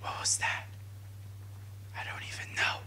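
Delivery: hushed, breathy whisper; soft voiceless whisper. Real, not acted. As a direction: whisper it, as if trying not to be heard. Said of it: "Why am I whispering?".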